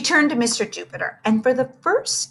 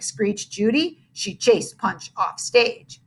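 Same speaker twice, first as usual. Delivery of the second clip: ordinary delivery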